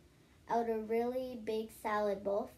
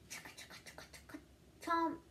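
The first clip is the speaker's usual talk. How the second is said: much softer whispered chugga, then a higher chomp; whispered gobbling noises; 6 semitones higher, 5 dB quieter, much faster than usual. Acted. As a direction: whisper the eating noises, bite at the end. Gobbling pie sound.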